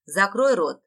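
loud, forceful order to shut up; acted vicious command; angry snarl. A narrator's reading. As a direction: snap it out with venom. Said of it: "зло прошипел Ратмир".

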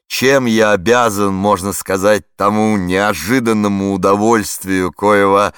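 loud, slow, forceful push through the long phrase; storming the phrase. A narrator's reading. Flustered warden to the radio reporter, loud forceful attack. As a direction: charge at the sentence headlong. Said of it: "теперь уже штурмом, осилить громоздкую фразу".

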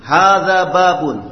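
loud, clear, deliberate Arabic vocabulary reading; model reading drill. Shown as a demonstration. Teacher modelling Arabic words for students, loud and clear. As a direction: read each phrase out crisply for the class to copy. Teacher leads class repetition.

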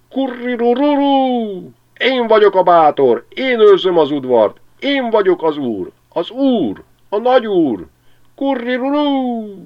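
very high, loud, slow crowing rooster boast; haragos kakas. A narrator's reading. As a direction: crow and strut, the angry lord of the yard. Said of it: "elsötétült a haragtól". (a kakas dühösen kukorékol, nagyon magasan, hangosan)

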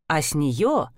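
ordinary delivery

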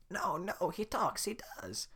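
put-on old woman insisting her donkey talks; pleading old woman. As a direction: beg them to believe her.

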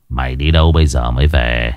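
put-on an old lord demanding where the servant was; gruff old master. A narrator's reading. (giọng lão chủ trầm, quát hỏi)

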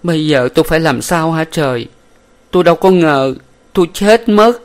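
high, loud anguished wail; despairing cry. A narrator's reading. Huyen pushing the madam away, crying out in anguish. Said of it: "hất tay chị Bảy Ớt ra".

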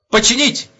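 high, loud one-word answer; smart military reply. Acted. Private gives the major the correct answer, loud and eager. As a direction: answer promptly like a recruit.

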